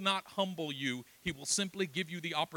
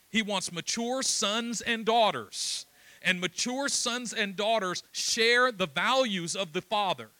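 slow, weighty, forceful sermon delivery; emphatic preaching; 3 semitones higher, 5 dB louder, slower than usual. Real, not acted. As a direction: drive each phrase home to the congregation.

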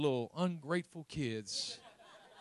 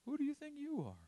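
high, softer sneering taunt; mocking inner voice; 13 semitones higher, 4 dB quieter than usual. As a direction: echo the scornful voice in your head. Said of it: "you already hear it in your head".

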